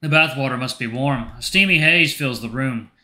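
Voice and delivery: ordinary talk, nothing marked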